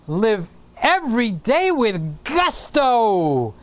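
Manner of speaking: slow, sweeping theatrical proclamation; hammed-up gusto. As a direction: swing up and down on each big word. Acts out Sly's rousing advice.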